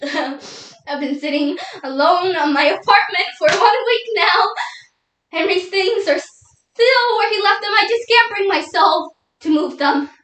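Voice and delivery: tearful, rising and swelling lament; grieving widow, distraught. Acted. Widow alone with Henry's things for a week, tearfully distraught.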